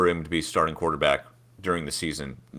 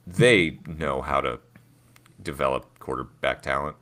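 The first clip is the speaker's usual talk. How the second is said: normal talking voice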